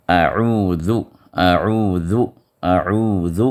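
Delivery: اعوذ ending in ذ with the tongue tip out between the teeth; اعوذ with a clear th-like ذ; dhal with tongue out. Shown as a demonstration. Teacher showing the tongue-tip ذ in اعوذ. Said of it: "ذال کے لیے زبان کی نوک باہر آئے".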